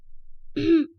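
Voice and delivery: short high "ahem"; nervous throat-clearing. A narrator's reading. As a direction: clear your throat, a little flustered. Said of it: "حمحمت بتوتر".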